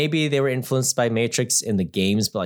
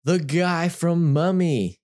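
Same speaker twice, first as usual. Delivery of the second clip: higher, drawn-out mock recognition; slow, pitched-up quote of delighted recognition; imitated excited recognition; 7 semitones higher, slower than usual. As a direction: imitate a fan spotting a familiar actor. Quotes someone recognizing an actor.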